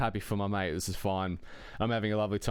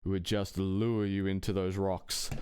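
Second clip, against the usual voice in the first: usual speaking voice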